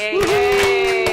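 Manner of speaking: high, loud little cheer; mock cheer. Real, not acted. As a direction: cheer it like a fan.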